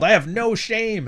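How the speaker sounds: high, slow, smug mockery; a mocking imitation of a shameless streamer; mocking Sneeko's smug line. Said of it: "act like, uh, Sneeko".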